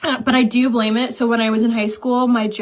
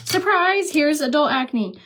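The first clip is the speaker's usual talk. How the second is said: much higher mock cheerful surprise; sarcastic surprise; 6 semitones higher, slower than usual. Real, not acted. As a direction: announce it like a cruel joke. Sarcastic announcement.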